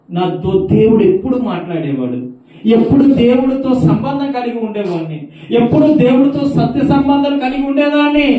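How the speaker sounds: high, loud anguished cry; weeping caller. Retold or imitated. Daughter of a preacher lamenting to the brother, loud anguished cry. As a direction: cry out in shame.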